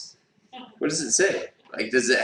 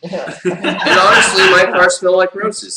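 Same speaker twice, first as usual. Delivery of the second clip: laughing; 4 semitones higher, 6 dB louder than usual. Real, not acted. Joking boast while laughing.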